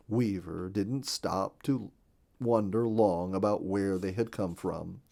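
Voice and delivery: usual speaking voice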